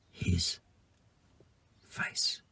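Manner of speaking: breathy whispered ending; whispered, almost gone. Acted. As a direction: let the last words die into a whisper. Whispered end of reading.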